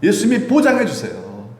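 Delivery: ordinary talk, nothing marked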